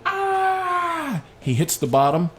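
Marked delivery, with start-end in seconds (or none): 0.1-1.2 put-on high shrieking kid yell going down a slide; imitated high-pitched scream; child's scream. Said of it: "He's screaming".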